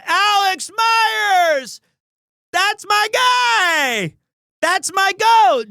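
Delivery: high, loud, slow drawn-out hype call; hype shout. Acted. Streamer hyping friend Alex to chat, slow loud shout. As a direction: stretch every word like an arena announcer.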